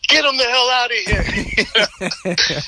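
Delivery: slow, dragged-out mock exasperation. Retelling Gino telling him to get his dad out, slow mock exasperation. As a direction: draw out each word, fed up. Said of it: "he goes".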